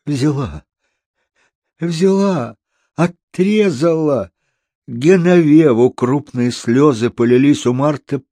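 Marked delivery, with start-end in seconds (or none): 0.0-4.3 всё выше и протяжнее, с отчаянием; жалуйся растерянно, растягивая слова; отчаяние. A narrator's reading. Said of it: "с отчаянием в глазах". (горестная детская жалоба)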